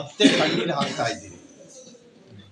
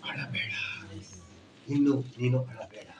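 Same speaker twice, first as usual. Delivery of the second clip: tender comfort; 7 semitones lower, 8 dB quieter than usual. Acted. Softens to comfort the weeping.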